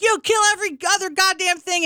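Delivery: high, loud scolding of the cat; retold yell. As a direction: yell at the cat in mock outrage. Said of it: "And I start screaming".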